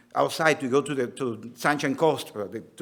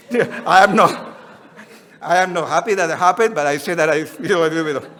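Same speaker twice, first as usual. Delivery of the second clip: laughing through the words; chuckling while quoting; retold reply with a laugh; 5 dB louder than usual.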